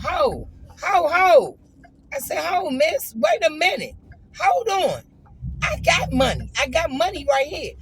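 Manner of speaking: loud, high call after someone walking away; calling her back. Retold or imitated. Calling after the bartender who walked off, loud and high. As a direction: raise it up and stop her in her tracks.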